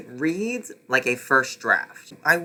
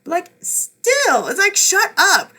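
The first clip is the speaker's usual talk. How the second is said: much higher, louder fed-up shriek; exasperated shout; 8 dB louder than usual. Real, not acted. Reviewer losing patience with the scared character, shrill shout. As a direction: shriek shut up at the book. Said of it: "exasperated at the character".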